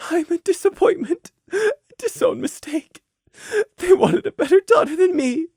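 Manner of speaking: very high, crying inner voice; tearful anguish. Acted. Mare's hurt thoughts about her parents, tearful anguish. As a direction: sob the self-loathing out.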